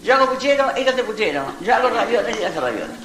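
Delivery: high, quoting the famous line; quoting fans. Retold or imitated. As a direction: chirp the film line back. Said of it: "cómo me saluda la gente".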